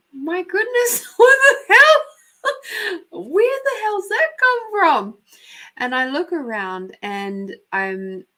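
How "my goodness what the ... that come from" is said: high, laughing mock shock; loud burst of amused bewilderment; startled disbelief. Woman recounting a voice heard in her head, startled disbelief.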